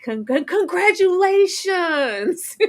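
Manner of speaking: stammering gush. Retold or imitated. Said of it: "Shannon D said".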